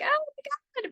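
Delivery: a quick cry of fear in the cabin; panicked passenger. Retold or imitated. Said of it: "maybe even screaming".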